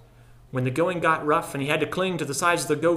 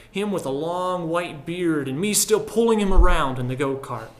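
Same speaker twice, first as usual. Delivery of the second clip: ordinary delivery, nothing marked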